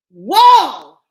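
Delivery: high, loud call to the wall. Acted. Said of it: "said Usha louder this time".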